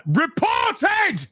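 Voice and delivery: high, slow mock shout. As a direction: shout it like all caps. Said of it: "I had to shout that 'cause it's in capitals".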